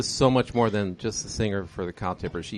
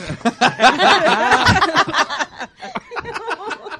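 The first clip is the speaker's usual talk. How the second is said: snorting laughs; 6 dB louder than usual. Real, not acted. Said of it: "Totally in the spank bank".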